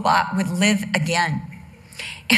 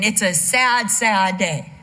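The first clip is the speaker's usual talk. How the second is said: ordinary delivery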